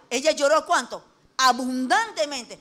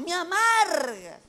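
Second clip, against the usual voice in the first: queja amarga; 4 semitones higher, much slower than usual. Retold or imitated. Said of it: "Y así decimos".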